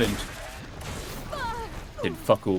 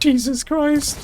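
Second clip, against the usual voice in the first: much higher startled cry; shocked exclamation; much slower than usual. Real, not acted. Gamer shocked by the boss's attack, high startled cry. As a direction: caught off guard.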